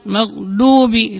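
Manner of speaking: "maghdubi" with a plain light d; "maghdubi" slowly, dhad flattened into dal; dal mistake. Shown as a demonstration. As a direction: say "maghdubi" wrongly as "mag-duubi". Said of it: "dibaca dengan dal".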